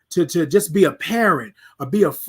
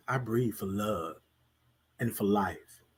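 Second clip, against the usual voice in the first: much softer, lower, almost murmured reply; quiet answer; 10 semitones lower, 12 dB quieter, slower than usual. Shown as a demonstration. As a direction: answer yourself very softly. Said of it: "Titan, how do you breathe?".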